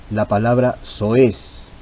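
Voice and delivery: "soez" said slowly as so-ez; slow syllable demo. Shown as a demonstration. Teacher to students modelling the hiatus in "soez", slowly. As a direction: draw out "soez" so the two open vowels split. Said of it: "dos vocales abiertas en sílabas distintas".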